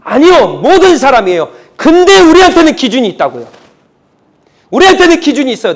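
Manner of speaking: loud, high-pitched preaching at full force. Real, not acted. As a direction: press each point home forcefully. (힘주어 외치는 설교)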